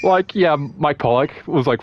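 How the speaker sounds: ordinary talk, nothing marked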